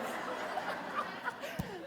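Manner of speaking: high giddy laugh at herself; real laugh. Real, not acted. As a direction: burst into an embarrassed giggle.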